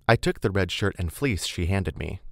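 plain reading voice

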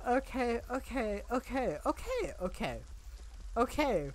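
high, breathless okays; frantic self-calming okays; panicking. Real, not acted. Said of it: "panicking noises".

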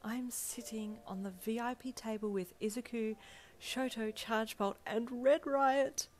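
high, giddy listing of names, building; starstruck disbelief. A narrator's reading. You pinch yourself at the VIP table, starstruck. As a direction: marvel at each name, stretching the last.

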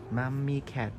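ordinary talk, nothing marked

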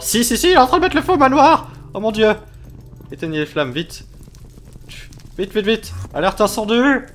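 playful panic over a burning house; mock fire panic. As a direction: sound the alarm, hammed-up panic. Manor set on fire, panic.

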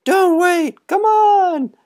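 put-on very high, loud boys' cry; high excited boys shout down; yelling. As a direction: call him up to join them. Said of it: "the boys yelled".

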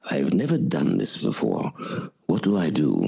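low, hushed confession in his voice; low imitation of the actor whispering a confession; imitating the actor. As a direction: lean in and ask low like a nervous veteran. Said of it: "he leaned over to me and he says".

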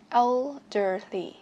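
put-on "Elderly" slowly, el, der, ly apart; teacher's slowed-down "Elderly", syllable by syllable; slow syllable breakdown. Shown as a demonstration. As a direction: stretch each syllable of "Elderly".

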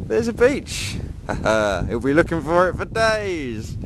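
high, lightly voiced teasing hotel staff; a hint of sly friendly locals; mock reception staff. Vlogger imagining staff fooling English tourists, high mock-cheerful voice. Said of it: "reception people saying".

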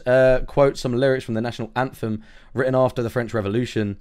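ordinary talk

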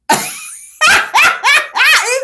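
high loud shrieking laugh; real high laughter. Real, not acted. Laughing at herself again.

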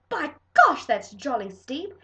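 loud, high outraged cry; indignant cry. Acted. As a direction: protest the price in shock. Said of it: "She cried".

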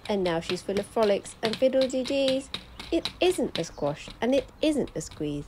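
plain reading voice

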